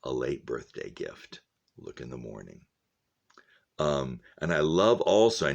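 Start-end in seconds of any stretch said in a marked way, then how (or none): 0.0-2.6 softly re-voice the quiet gift; hushed reread. Shown as a demonstration. Teacher rereading Max's words to class, hushed. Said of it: "His words knelt next to her".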